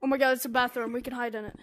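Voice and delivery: ordinary delivery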